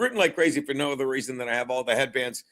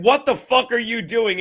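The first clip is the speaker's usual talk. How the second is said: higher, louder imitation of an exasperated questioner; mock questioner; 6 semitones higher, 5 dB louder than usual. Host retells somebody challenging the coach, mock-exasperated. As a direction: throw the question at him, incredulous. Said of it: "somebody said".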